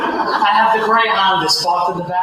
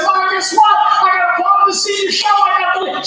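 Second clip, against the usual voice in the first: put-on excited kid; 8 semitones higher than usual. Teen son telling his mother about the lead, excited. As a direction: burst in bragging to mom.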